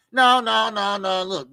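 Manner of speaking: ordinary talk, nothing marked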